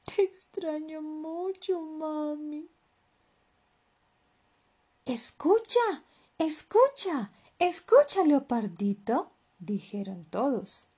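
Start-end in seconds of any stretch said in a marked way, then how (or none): 0.0-2.7 high, slow, wistful voice; plaintive child missing mum; drawn-out sorrowful little voice; sighing little cub. Acted. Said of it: "suspiró Leopardito". 5.0-9.3 high, loud repeated calls; urgent animals getting the cub's attention; eager loud summons; excited calling. Acted. All animals call excitedly.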